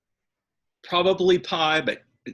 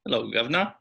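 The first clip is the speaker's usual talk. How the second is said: Cockney accent; faster than usual. Shown as a demonstration. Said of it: "That's pitiful".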